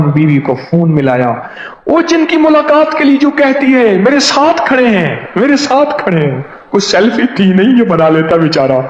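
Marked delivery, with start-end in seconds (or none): 4.0-6.4 high, thrilled repetition; husband telling his wife on the phone, excited; excited phone call. Retold or imitated. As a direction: tell her the news breathlessly.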